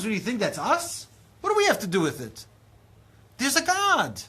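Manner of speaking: very high, loud incredulous shout; Abraham shouting in disbelief at the guests; exasperated Abraham. Retold or imitated. Abraham shouting at guests about the creator. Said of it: "He's like".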